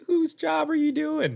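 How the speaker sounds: co-worker mocking the captain's job, high-pitched; mock indignant. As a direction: squeak it out in disbelief. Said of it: "I'm like".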